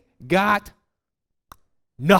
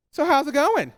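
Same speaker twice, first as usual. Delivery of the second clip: teasing spouse; 9 semitones higher than usual. Retold or imitated. Spouse heckling, mock sweet.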